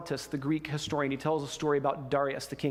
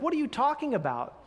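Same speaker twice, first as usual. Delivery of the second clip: confused; 8 semitones higher than usual. Retold or imitated. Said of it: "the king is super confused".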